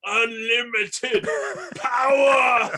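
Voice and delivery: slow, loud, booming sith cry; Emperor Palpatine shout. Acted. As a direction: bellow it like an evil emperor.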